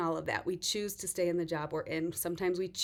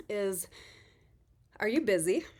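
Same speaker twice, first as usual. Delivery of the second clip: ordinary delivery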